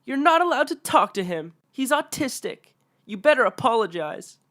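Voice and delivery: very high, loud outraged mother voice; entitled mom scolding. Acted. Entitled mother scolding the narrator, very high and loud.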